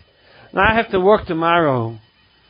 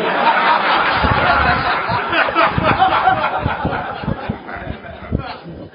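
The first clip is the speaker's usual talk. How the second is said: long high burst of real laughter; 9 semitones higher than usual. Real, not acted. Laughing at his own excuse.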